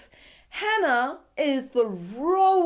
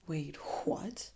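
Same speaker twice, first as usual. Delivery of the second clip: stunned, breathy whispered question; hushed what-did-he-just-say; 4 semitones lower, 10 dB quieter than usual. Real, not acted. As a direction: whisper in shocked disbelief. Disbelief at Luke's words.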